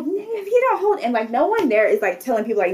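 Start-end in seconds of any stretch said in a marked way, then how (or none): 0.0-1.0 retold screaming. Cussing out the yelling tro driver, mock screaming. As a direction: imitate yourself screaming, pitched way up. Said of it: "I'm screaming".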